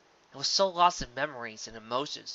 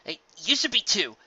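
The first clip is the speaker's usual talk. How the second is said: firm self-scolding; 9 semitones higher, 5 dB louder than usual. Acted. Dash scolding herself inwardly.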